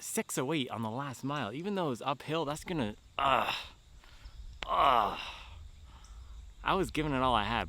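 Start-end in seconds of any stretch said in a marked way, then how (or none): 3.2-5.2 frustrated groan. Real, not acted. Groaning over a slow mile.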